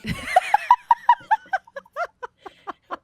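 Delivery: long, high-pitched laughing fit; helpless laughter. Real, not acted. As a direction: crack up completely, very high. Cracks up at the Franken-thing.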